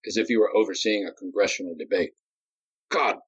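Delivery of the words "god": slowed, raised outburst; mild angry cry. A narrator's reading. Nadi cursing Darius, angrily. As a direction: lash out at Darius. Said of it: "Nadi cried".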